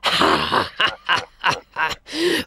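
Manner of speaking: low scornful lion laugh; a big cat's mocking chuckle; lion laughing at the boy. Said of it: "The lion stopped and laughed".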